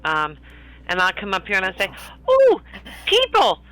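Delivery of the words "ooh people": high delighted squeal; excited. Acted. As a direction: gasp it out, thrilled. Character calls out excitedly.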